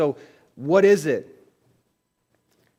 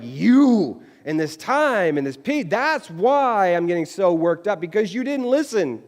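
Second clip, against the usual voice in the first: mock angry blame; 6 semitones higher, 5 dB louder, slower than usual. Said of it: "you're gonna be like".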